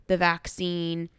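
ordinary talk

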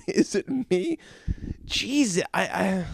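ordinary talk, nothing marked

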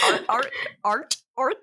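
barked out like a seal; short loud barks like a seal. Real, not acted. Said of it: "I sound like a, like a ... A seal".

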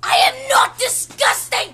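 loud offended protest; indignant shout. Pretend. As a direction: yell it back, insulted. Cat protesting loudly.

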